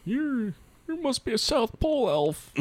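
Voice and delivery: very high put-on voice; mock movie-line voice. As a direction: pitch it way up for a joke quote. Quoted film line in put-on voice.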